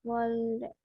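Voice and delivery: Olde said slowly and clearly as a teacher's model; pronunciation model. Shown as a demonstration. Teacher modelling Olde for the class, clear and careful. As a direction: sound out Olde for the students. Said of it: "Originalmente esto se pronuncia como olde".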